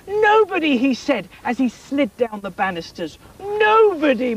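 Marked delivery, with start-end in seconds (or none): 0.0-0.8 high, louder joyful nobody; happy king. Acted. King happily telling the queen, high joyful exclamation. 3.4-4.4 high, louder joyful nobody; happy king. Acted. King happily telling the queen, high joyful exclamation.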